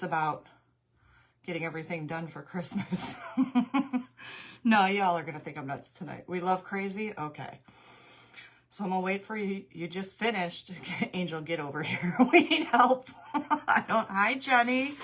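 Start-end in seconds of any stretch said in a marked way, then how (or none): 3.2-4.6 bright burst of giggling; high giggle. Real, not acted. Laughing at her own craziness. 12.2-15.0 laughing. Real, not acted. Laughing plea about holiday chaos.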